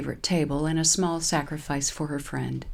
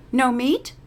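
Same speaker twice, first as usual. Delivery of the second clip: chiding; 8 semitones higher, 5 dB louder than usual. Acted. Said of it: "the board server chides".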